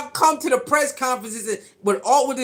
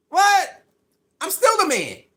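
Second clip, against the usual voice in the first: high, punchy mock disbelief; incredulous swagger; 7 semitones higher than usual. Player answering a reporter's humble question, acted incredulous swagger. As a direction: throw the question back, pitched way up.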